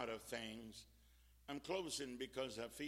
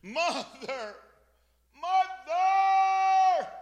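high scream growing louder and longer; retold scream; 17 dB louder, much slower than usual. Lady on crutches running to the fire, screaming. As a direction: scream the name, then stretch it out even louder. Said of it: "kept screaming".

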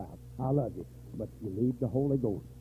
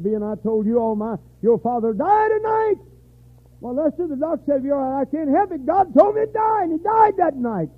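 rising preaching; 11 semitones higher, 7 dB louder than usual. Retold or imitated. Retold prophecy, voice climbing.

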